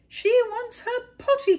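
very high, loud urgent call; cried out. A narrator's reading. King passing the call along, loud high cry. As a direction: pass the alarm on at full voice. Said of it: "cried the king".

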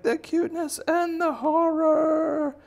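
very high, loud, drawn-out wail; mock horror wail. Townsfolk facing cute horror, shrieky hammed-up wail. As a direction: cry out in hammed-up panic, slowly.

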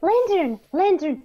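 calling out. Real, not acted. Calling another player by name.

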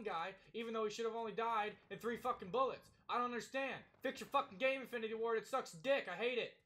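loud, high furious rant; angry rant. Real, not acted. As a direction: rage at the game. Ranting at the game.

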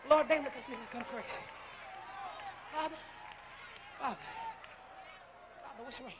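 low, intimate hushed sermon voice; hushed preaching. Acted. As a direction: bring it down to a murmur. Long hushed, intimate preaching passage.

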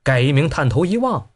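ordinary delivery, nothing marked

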